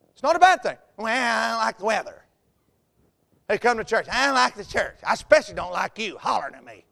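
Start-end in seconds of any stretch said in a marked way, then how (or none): 1.0-2.2 put-on whiny complaint; whiny complainer. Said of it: "people complain about everything". 4.1-6.8 put-on whiny complaint; whiny complainer. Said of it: "people complain about everything".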